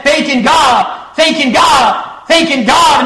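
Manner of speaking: rising preacher's shout; building shout. Real, not acted.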